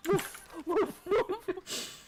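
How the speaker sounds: high mock woofing; jokey spoken woofs; playful dog barks. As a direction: bark along to a silly chant. Barks along to the parody chant.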